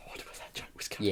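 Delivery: soft, whispered grumble; muttered aside. Real, not acted. Co-host reacting to a groaner pun, muttered aside. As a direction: say it under your breath, almost voiceless.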